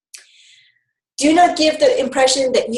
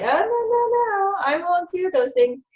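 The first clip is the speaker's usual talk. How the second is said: high, playful protest; mock firm refusal; 8 semitones higher than usual. Shown as a demonstration. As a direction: brush it off in a lightly put-on high voice. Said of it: "and say".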